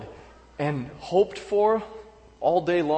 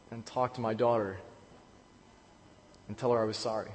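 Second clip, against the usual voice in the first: ordinary talk, nothing marked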